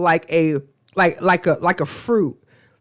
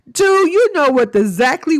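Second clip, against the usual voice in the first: calling out; 7 semitones higher, 4 dB louder than usual. Real, not acted. Calls out Chris loudly.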